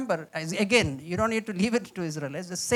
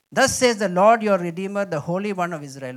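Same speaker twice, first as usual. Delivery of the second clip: solemn scripture reading; 6 dB louder than usual. Shown as a demonstration. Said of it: "Let me read this".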